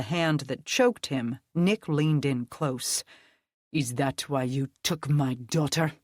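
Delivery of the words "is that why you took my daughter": lower, menacing father's demand in a deep voice; deep voice shaking with rage; deep, raging. Acted. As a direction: growl it close to his face. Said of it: "voice deep now and nearly vibrating with rage".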